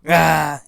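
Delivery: loud hammed-up yell; mock battle cry. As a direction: shout a cartoon fighting cry. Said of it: "And I go".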